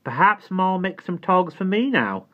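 put-on high, loud hopeful child voice; a girl wishing for new clothes; eager little girl voice. A narrator's reading. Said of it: "Dido said hopefully".

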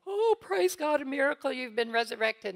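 overjoyed cry of a miracle, hammed up; mock ecstatic mother. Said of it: "she didn't go".

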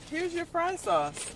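slow, high mock server. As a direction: hand it over like a bored cashier. Mimicking the server handing sauce.

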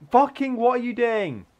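loud, high yell at a teammate; high, loud outburst of frustration; exasperated shout. Real, not acted. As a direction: shout it in disbelief. Yells at another player's move.

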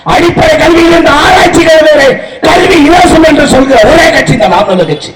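ordinary talk, nothing marked

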